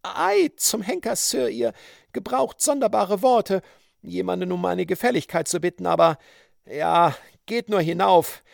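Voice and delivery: high-pitched woman, protesting then giving in; flustered indignant woman. Acted. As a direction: grumble in a high voice, then relent. Voices Mrs Bridleford. (aufgebrachte hohe Frauenstimme, dann nachgebend)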